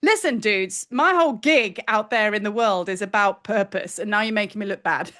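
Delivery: normal talking voice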